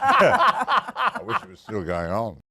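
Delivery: slow, high and loud, through laughter; laughing lament. Real, not acted. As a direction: stretch it out in a laughing mock wail. Joking about Harry Potter money.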